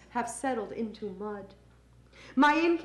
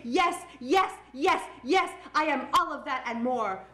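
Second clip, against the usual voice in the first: emphatic defiance. Acted. Poet owning every label, emphatic and defiant. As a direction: pitch each yes up and set it apart. Emphatic refrain answering name-callers.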